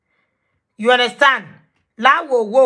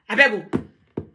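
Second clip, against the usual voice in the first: ordinary talk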